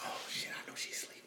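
hushed whisper to yourself; barely audible, retold drunken whisper; sneaking whisper. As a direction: whisper it so the sleeper won't wake. Sneaking in past sleeping roommate.

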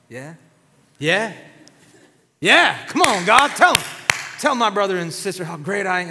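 egging God on, smug; smug gloating. Pretend. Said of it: "if I'm Moses, I'm like".

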